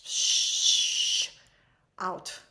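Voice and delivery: usual speaking voice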